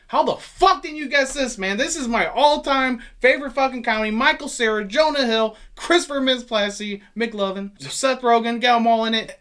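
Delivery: loud, high, fired-up fan rant; incredulous, pumped-up enthusiasm; excited raving. Real, not acted. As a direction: gush over an all-time favorite. Raving about his favorite comedy.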